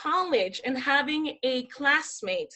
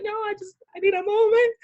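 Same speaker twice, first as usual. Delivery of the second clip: high, choked-up plea imitated; acting out being overwhelmed; mock tearful; 7 semitones higher, 3 dB louder, faster than usual. Crying model asks photographer for time, mock tearful.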